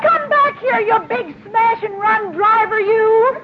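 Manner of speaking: high, indignant yell after the car; angry shout. Acted. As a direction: shout after the driver in outrage. Calling after a fleeing driver.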